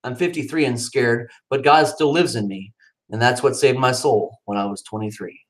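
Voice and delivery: usual speaking voice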